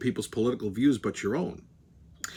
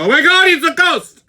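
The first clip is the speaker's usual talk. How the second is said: mock shriek; 11 dB louder than usual. Imagined viewers shocked.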